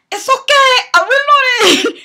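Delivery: loud, high-pitched mock accent shout; Tony Montana. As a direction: burst into an over-the-top movie gangster impression. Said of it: "the whole Tony Montana thing".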